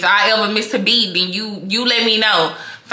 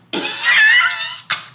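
burst of laughter. Real, not acted. Vlogger laughing at her own joke, high shrieking laugh.

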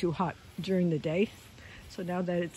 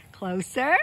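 ordinary delivery

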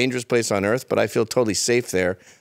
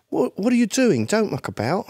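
higher voice of a Cockney's son, Jamaican-tinged London; young London accent; 10 semitones higher than usual. Retold or imitated. Guest voices the Cockney's son, new London accent. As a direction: imitate London youth who lost the Cockney accent. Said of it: "You meet that guy's son".

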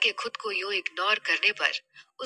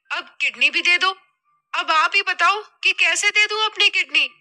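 higher, louder daughter voice, indignant; झुंझलाई बेटी; 5 semitones higher, 5 dB louder than usual. Acted. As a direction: plead your case to mother in a worked-up voice. (बेटी की ऊँची, झुंझलाई आवाज़)

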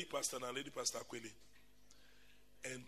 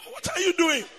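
laughing; 10 semitones higher, 5 dB louder than usual. Retold or imitated. Protesting while laughing.

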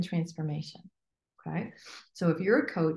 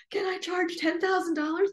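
timid asking; 11 semitones higher than usual. Retold or imitated. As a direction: ask it nervously. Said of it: "But if you're like".

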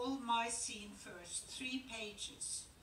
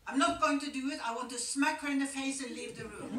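loud, higher, laughing outburst; furious refusal; 4 semitones higher, 10 dB louder, faster than usual. Retold or imitated. Actress furious at the line she must say, loud outburst. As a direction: retell it as a loud laughing protest. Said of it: "She says".

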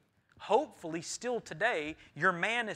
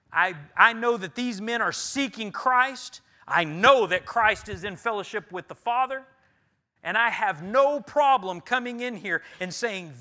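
loud, rising sermon delivery, hammering each clause; full-force preaching; 5 semitones higher, 11 dB louder than usual. Acted. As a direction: preach it out to the congregation with fire.

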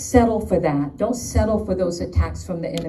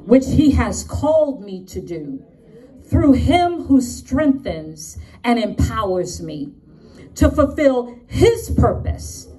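New York attitude; 6 semitones higher, 4 dB louder than usual. Shown as a demonstration. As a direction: punch each phrase with New York swagger. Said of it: "say it with my New York attitude".

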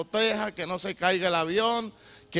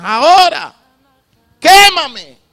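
high, loud pleading cry of "quémame"; crying out in prayer; 6 semitones higher, 13 dB louder than usual. Retold or imitated. Preacher reliving his prayer to the Spirit, loud anguished cry. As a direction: beg the Holy Spirit to burn him clean, shouting. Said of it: "le decía llorando".

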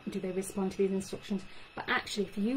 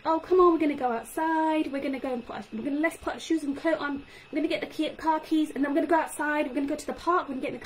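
put-on too much talk to a child; 5 semitones higher, faster than usual. Shown as a demonstration. Speech therapist showing parents the wrong way, run-on chatter. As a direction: gush everything at once like a busy parent. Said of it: "So not like".

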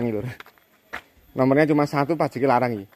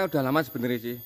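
ordinary talk, nothing marked

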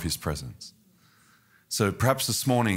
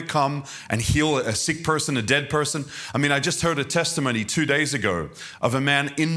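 full-force preaching; 5 semitones higher than usual. Acted. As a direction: preach it with rising fire.

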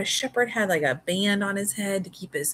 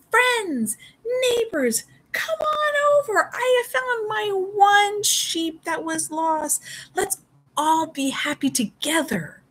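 pitched up and calling out, overjoyed and tearful; joyful calling out; 13 semitones higher, 4 dB louder, slower than usual. Acted. Shepherd calling friends and neighbours to celebrate, overjoyed and loud. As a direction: shout to the neighbours, bursting with joy. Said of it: "The shepherd called out".

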